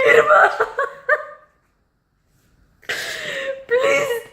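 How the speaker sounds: high, loud half-laughing cry; drawn-out, laughing wail of pleading; wailing plea. Real, not acted. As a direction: beg and whine as if in tears. Begs hurricane for power back.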